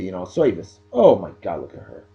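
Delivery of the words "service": put-on "service" as "soyvice" in New Jersey accent; New Jersey accent, "service" with an oy vowel. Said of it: "That's how they say it in Jersey".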